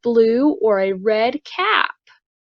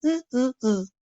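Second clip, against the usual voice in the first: monkey chatter; 4 semitones higher than usual. Acted.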